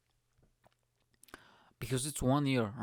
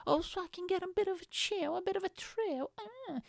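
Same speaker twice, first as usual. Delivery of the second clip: much higher, prissy put-on voice; mock thrill seeker. Climber lecturing Mister Mechanic about his thrill, much higher mock voice. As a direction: lampoon the climber's adrenaline talk. Mocking adrenaline-junkie climber.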